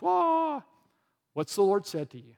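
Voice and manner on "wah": high, loud mock baby wah; hammed-up crying wah; mock wail. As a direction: wail like a whiny child. Mock crying of a stressed believer.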